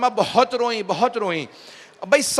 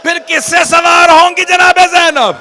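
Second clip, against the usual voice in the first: lamenting preacher; 7 semitones higher, 10 dB louder than usual. Acted. Preacher lamenting the mounting of the women to the weeping congregation, loud wailing recitation. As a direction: pour grief over the mourners at full force.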